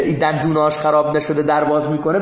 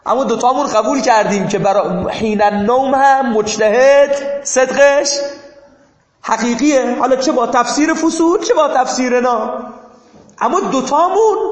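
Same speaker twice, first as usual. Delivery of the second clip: emphatic raised lecturing; 7 semitones higher, 5 dB louder than usual. Real, not acted. Teacher stressing point to students.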